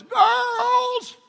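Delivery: very high falsetto mock call; wife's feeble yell. Wife calling girls upstairs, high falsetto attempt at yelling. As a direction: imitate a woman who cannot yell, shrieking thinly. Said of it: "When she calls the girls".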